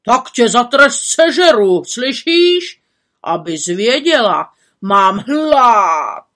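roaring hungry cannibal threat. A narrator's reading. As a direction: growl then roar like a hungry ogre. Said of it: "řval lidojed".

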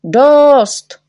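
loud, high shout of "Dost"; angry shout. A narrator's reading. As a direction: shut him down in one blast. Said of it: "křičeli všichni".

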